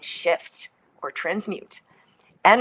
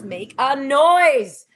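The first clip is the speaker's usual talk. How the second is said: drawn out, much louder and higher; building loud release; 7 semitones higher, 10 dB louder, much slower than usual. Shown as a demonstration. Teacher urging listeners to let sound out, loud and drawn out. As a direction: let the words swell into a big noise. Demonstrating letting out a noise.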